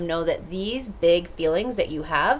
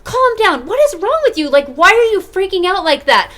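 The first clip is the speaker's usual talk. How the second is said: a much higher, much louder exasperated parent yelling; 12 semitones higher, 12 dB louder than usual. Retold or imitated. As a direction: snap at the kid like a fed-up parent. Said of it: "how do people usually react".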